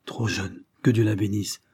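ordinary delivery

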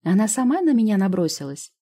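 loud indignant insistence. A narrator's reading. Медсестра возмущённо оправдывается перед профессором. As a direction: protest your innocence hotly. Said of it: "возмутилась Жанночка".

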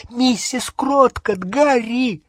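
high, drawn-out alarmed shout; urgent warning. Acted. Hermione alerting Harry to Mrs Cattermole, urgent cry. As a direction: cry out to warn him urgently.

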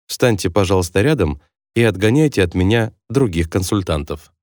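ordinary talk

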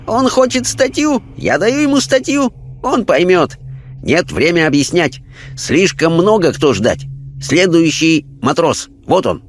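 high, sing-song broken speech; Chinese spirit guide. Acted. Chinese guide calling up the next spirit, high-voiced. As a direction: announce it high, in simple chopped phrases. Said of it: "Китаец исчез".

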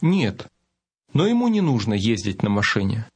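ordinary delivery

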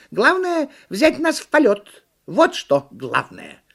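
high, loud monkey insistence; pushy cartoon monkey; bossy monkey. A narrator's reading. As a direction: squeal the demand, then land the last phrase emphatically.

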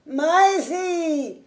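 high call across the house. A narrator's reading. Servant calling the maid from the door, high call. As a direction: call her name from afar. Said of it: "appela-t-il".

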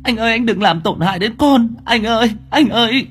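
high, desperate crying plea; panicked wail. Acted. Wife wailing at her husband to spare the child. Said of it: "vội vàng gào lên".

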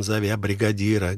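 plain reading voice

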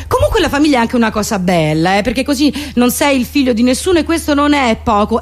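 ordinary talk

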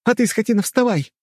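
high, frantic shriek to back off; desperate high-pitched yelling; angry screaming. A narrator's reading. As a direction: scream while pounding on someone's chest. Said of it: "кричала Рэй, продолжая бить парня".

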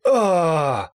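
high, loud falling wail; agonised scream. A narrator's reading. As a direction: scream as life is drained. Призрак вопит от касания. (крик боли)

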